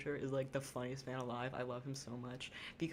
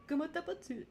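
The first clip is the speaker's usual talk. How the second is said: squeaky high, loud mock French; shrill-free but sky-high French imitation, louder; podcaster's goofy French voice, much higher; Curtis voice; 5 dB louder than usual. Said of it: "like, Curtis voice he does on his podcast".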